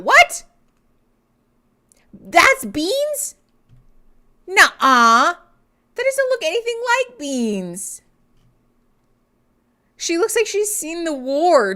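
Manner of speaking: high, loud shocked disbelief; can't believe that's the same cat; high incredulous squawk. Real, not acted. Shocked the cat looks different.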